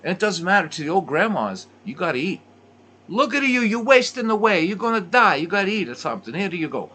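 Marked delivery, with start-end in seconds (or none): 3.1-6.9 much higher, louder scolding nonna voice; worried grandmother shoving a plate at you; fussing Italian grandma. Retold or imitated. Old grandma telling a guest he's wasting away, loud high fussing.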